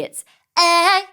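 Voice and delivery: "A" hit short and sharp; snappy. Shown as a demonstration. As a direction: snap out the "A". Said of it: "It's very snappy".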